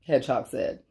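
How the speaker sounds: ordinary delivery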